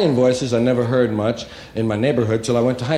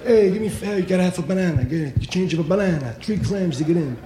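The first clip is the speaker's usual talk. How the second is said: street tough voice, a bit higher; Italian street guy; 9 semitones higher than usual. Retold or imitated. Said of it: "Money is bananas".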